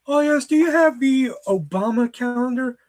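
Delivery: high-pitched imitation of a customer asking. Customer asks clerk for a calendar, high mimicked voice.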